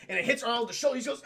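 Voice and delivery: ordinary delivery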